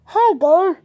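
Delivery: cheery host hello; vlogger hello. Acted. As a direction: greet viewers brightly. Another intro take.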